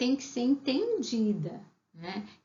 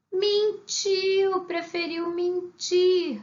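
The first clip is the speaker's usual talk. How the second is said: mock exclamation; 7 semitones higher, much slower than usual. Teacher exclaims the hidden meaning.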